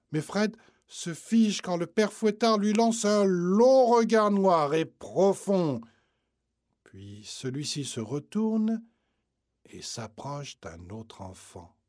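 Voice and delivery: usual speaking voice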